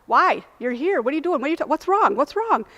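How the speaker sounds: high, loud flustered questions; startled questioning. Retold or imitated. Doctor to wailing patient, startled and baffled. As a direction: imitate someone alarmed, firing question after question.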